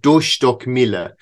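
"Dørstokkmile" said slowly, in a self-mocking Norwegian accent; Norwegian word. Shown as a demonstration. Host attempts the Norwegian word for listeners, slow. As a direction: sound out "Dørstokkmile" slowly, a mock Norwegian try. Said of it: "does the bad accent".

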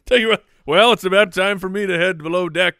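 high, loud mock announcement; pirate play-acting. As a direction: announce it like a pirate heading below. Acts out pirate checking before descending.

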